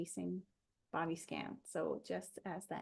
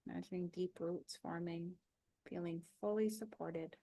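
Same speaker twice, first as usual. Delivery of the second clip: calm body-scan guide with long pauses; even, unhurried relaxation voice; guided meditation. Acted.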